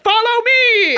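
shouted, high, laughing; leading the wedding guests out, laughing shout; mock rallying shout. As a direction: call the crowd to follow you, laughing.

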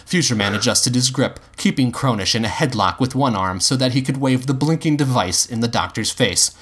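plain reading voice

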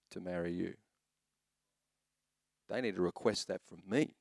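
low, quiet firmness; mock-stern dad. Father warning his little girl about husbands, quietly stern. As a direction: lay down the law softly and low. Dad lays down marriage rule.

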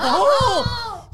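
high, long "oh" of alarm; re-enacted wail at the burning; mock cry. Patient retells being cauterized, mock cry.

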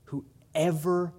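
usual speaking voice

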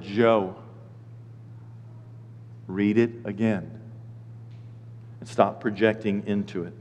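low, soft, long pauses; gentle correction. Retold or imitated. God tells Joe to reread, quiet correction. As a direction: correct him quietly and firmly.

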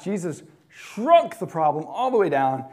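usual speaking voice

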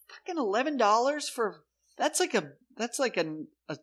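high, incredulous complaint about the price; exasperated outrage. Retold or imitated. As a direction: pitch it way up in disbelief. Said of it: "I'm like".

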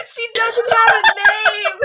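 high, slow, laughing through tears; cracking up in disbelief. Real, not acted.

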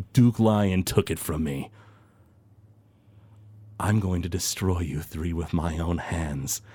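a lightly voiced gloating villain going quiet; empowered villain. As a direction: savour the new power, then threaten.

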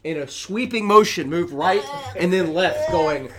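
normal talking voice

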